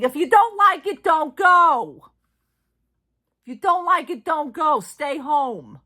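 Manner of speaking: high, forceful shout, throwing their words back; loud retold retort. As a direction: belt out the quoted dismissal, easing slightly at the end. Throwing back a retort once received.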